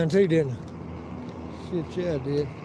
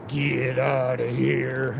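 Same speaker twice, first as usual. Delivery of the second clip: very slow, ghostly mimicked order; imitating drunk lady; 3 semitones lower, much slower than usual. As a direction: stretch every word like an unseen voice calling. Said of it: "I thought I was hearing ghosts".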